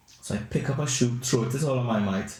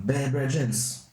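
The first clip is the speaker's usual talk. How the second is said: ordinary delivery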